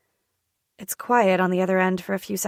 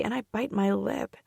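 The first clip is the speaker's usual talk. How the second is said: ordinary delivery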